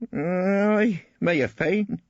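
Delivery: put-on slow, high, hesitant old witness voice; old witness admitting it very grudgingly; reluctant. A narrator's reading. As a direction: drag the admission out unwillingly. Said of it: "His answer was extremely reluctant".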